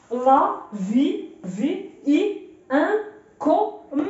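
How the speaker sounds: very slow, separated syllables. Shown as a demonstration. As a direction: read it out piece by piece, pausing between. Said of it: "والله حتى تعرف تقرا النص". (قراءة النص ببطء مقطع بمقطع)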